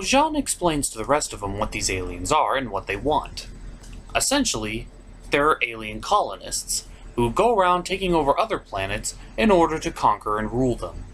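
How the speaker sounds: usual speaking voice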